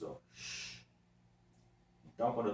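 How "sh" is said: quiet unvoiced sh; soft sh sound. Shown as a demonstration. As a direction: breathe out a quiet sh. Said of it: "esse som pode ser representado".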